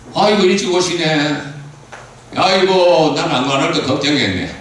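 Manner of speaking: high, loud, happy welcome; delighted greeting. Acted. Preacher greeting a deacon back from garlic farming, delighted. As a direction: greet her with joy. Greeting a church member warmly.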